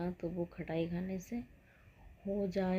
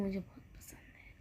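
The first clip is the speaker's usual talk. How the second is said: confiding whisper; 8 dB quieter than usual. Real, not acted. Secret love of mango curry.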